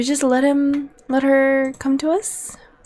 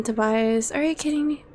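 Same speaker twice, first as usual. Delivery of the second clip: ordinary delivery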